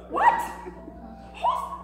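very high, loud yelp; shocked outburst. Acted. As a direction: yell in shock at an unwanted advance. Shocked at woman in bed.

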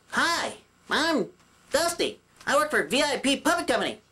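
high-pitched puppet introduction; beginner puppet voice. Shown as a demonstration. Puppet mimics how beginners talk, beginner puppet voice. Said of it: "you end up talking like this".

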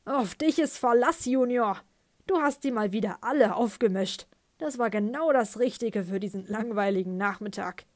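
loud, booming, amused; booming father. Acted. Father praises his son to the room, loud and booming. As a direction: boom it out with pride. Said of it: "Sein Vater lachte dröhnend".

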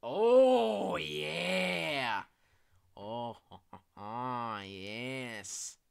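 loud gleeful whoop; thrilled cheer. Real, not acted. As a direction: cheer with delight. Thrilled to play monster.

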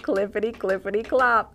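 fast clip-clop hoof sounds; playful clip-clop for kids; clip-clop sound effect. Acted. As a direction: click out hooves clippity clop. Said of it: "great sound effects there".

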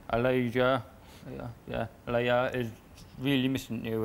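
ordinary delivery

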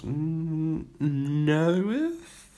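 puzzling over the sums aloud; musing Miss Palaver. A narrator's reading.

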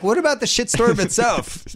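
high-pitched, exasperated retort; indignant protest. Retold or imitated. Acting out own protest to ESPN.

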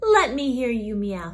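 ordinary talk

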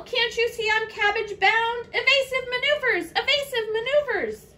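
high, loud snail in a flap; urgent alarm. A narrator's reading. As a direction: call it out urgently, as if dodging a crow. Snail fleeing a hungry crow.

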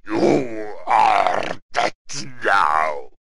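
very slow, loud, drawn-out doom voice; stretched-out, ominous pronouncement; voice of Death. Acted. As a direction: boom each word out as the Reaper. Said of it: "das könnte ja der Tod sein, der da sagt".

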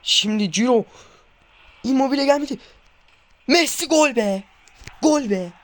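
urgent cheering as the play unfolds; loud rising cheers; excited shouting. Real, not acted. Near goal, then goal.

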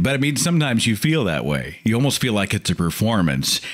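normal talking voice

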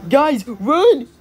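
panicked ghost. Acted. Ghost warning friends to run, loud panic. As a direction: yell at friends to flee. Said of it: "test voicing characters".

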